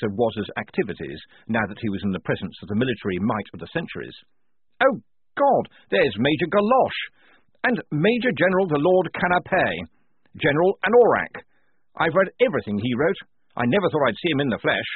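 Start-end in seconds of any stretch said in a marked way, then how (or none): 4.8-14.9 high, loud, thrilled officer; starstruck. A narrator's reading. Lieutenant spotting his dead military heroes, starstruck. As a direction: gush over each name, pitched up.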